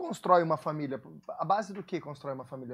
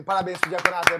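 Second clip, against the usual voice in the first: Parabéns pro diaconato called out louder and festive; louder congratulations; 8 dB louder than usual. Shown as a demonstration. Pastor rallying applause for the deacons, louder cheer. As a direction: lift it up so the room claps. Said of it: "Bate palma aí".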